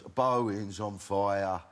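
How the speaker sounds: ordinary delivery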